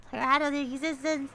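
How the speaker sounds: ordinary delivery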